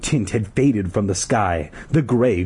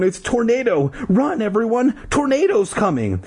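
high, urgent warning cry; mock shout; 13 semitones higher than usual. A narrator's reading. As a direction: warn a crowd in a high voice. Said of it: "shouted into the megaphone".

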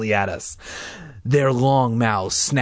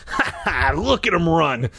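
laughing, pleased with himself; gloating laugh; 5 semitones higher than usual. Acted. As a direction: laugh as they run. Man laughs at fleeing creatures.

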